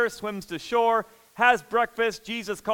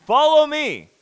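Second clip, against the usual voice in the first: higher and loud, a ringing summons; loud command; 7 semitones higher, 9 dB louder than usual. Acted. Jesus summoning Peter, loud ringing imperative. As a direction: proclaim it like an order. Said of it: "Jesus is giving an imperative saying".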